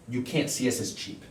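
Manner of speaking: ordinary talk, nothing marked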